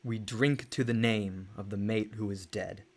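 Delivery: low, solemn toast to the dead; mournful recitation. Acted. Reciting mournful poem line.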